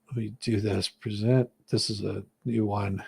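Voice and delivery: usual speaking voice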